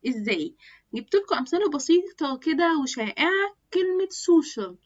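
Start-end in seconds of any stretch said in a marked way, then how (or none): 4.1-4.7 social said slowly as SO-shul with a clear sh; correct pronunciation. Shown as a demonstration. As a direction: model the English word social carefully, stretched out. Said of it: "النطق بتاعها".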